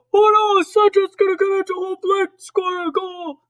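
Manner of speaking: high-pitched mock-alarmed snowman; high, urgent play-by-play cry; worried snowman voice. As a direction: squeal it like a panicking cartoon snowman. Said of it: "the snowman's like".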